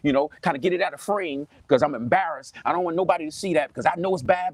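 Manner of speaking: ordinary talk, nothing marked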